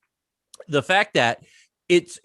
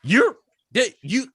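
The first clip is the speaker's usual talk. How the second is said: stammering, high-pitched amazement; retold astonishment; 10 semitones higher, slower than usual. Retelling first meeting with Tom Holland, astonished. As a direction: sputter in disbelief, pitched way up.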